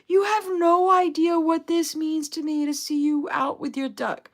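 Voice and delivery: overjoyed shout at first sight of the duck; enthusiastic. Retold or imitated. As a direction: gush it out like a thrilled fan. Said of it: "she was more enthusiastic".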